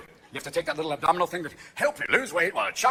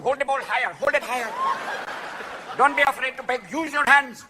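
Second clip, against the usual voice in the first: raised-pitch mock Indian accent; hammed-up Indian accent, higher voice; 5 semitones higher than usual. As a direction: coach insistently in a put-on Indian accent.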